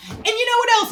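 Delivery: high, loud, bursting outburst; so excited. Retold or imitated. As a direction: butt in, unable to contain yourself. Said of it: "I was so excited".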